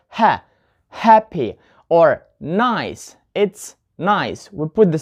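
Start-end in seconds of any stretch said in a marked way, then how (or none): 0.1-1.6 happy with HA said alone, then HA-ppy; stress on first syllable. Shown as a demonstration. Teacher showing an adjective, happy stressed on HA. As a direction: punch the HA in happy. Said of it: "put the stress on the first syllable".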